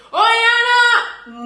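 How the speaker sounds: long, loud, high street call. Retold or imitated. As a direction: shout the name across the street, high and drawn out. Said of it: "no lo llames como dicen".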